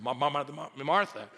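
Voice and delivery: stammering Ma- Ma- Ma- before Martha; mock stutter. Shown as a demonstration. Preacher showing what Jesus did not do, mock stutter. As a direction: deliberately stutter the name as a joke. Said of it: "Jesus did not stutter".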